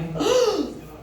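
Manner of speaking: sharp high gasp as the card turns; a hammed-up shocked intake of breath; theatrical gasp of the fortune teller; psychic's dramatic gasp.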